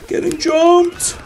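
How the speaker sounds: stretched-out, very high playful wail; playful high cry. Pretend. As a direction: drag it out like a cartoon yelp. Playful outcry at game ambush.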